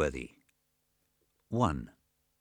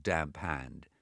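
usual speaking voice

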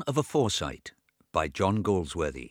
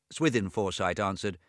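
ordinary delivery

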